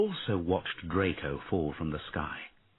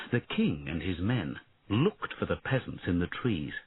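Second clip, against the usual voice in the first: usual speaking voice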